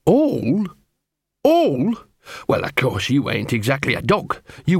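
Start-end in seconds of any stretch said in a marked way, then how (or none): 0.0-1.9 high, incredulous repeated question; indignant echo. Acted. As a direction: throw it back high, in outrage. Gaspode indignantly echoes Angua.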